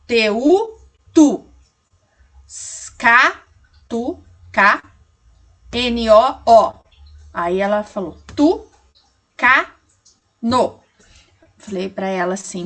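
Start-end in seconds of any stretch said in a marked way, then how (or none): 0.1-10.8 slow pauses between "tu", "ca", "no"; child reading "tu, ca, no" separately; sounding out syllables. Retold or imitated. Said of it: "Ela leu".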